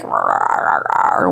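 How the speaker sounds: low, breathy growl imitating the dog; playful imitation of a dog grumbling; mock dog growl. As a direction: growl it out like a grumpy big brother dog. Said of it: "So he's like".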